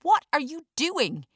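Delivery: high, loud furious scream; shrill outraged yell. A narrator's reading. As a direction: scream at the guard in disbelief. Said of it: "he screams at the security guard".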